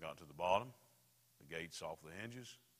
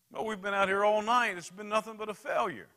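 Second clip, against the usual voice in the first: high, loud whining protest; complaining disciple; 14 semitones higher, 16 dB louder than usual. Acted. Tired disciple complaining to Jesus, loud and high. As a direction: plead that it's hopeless.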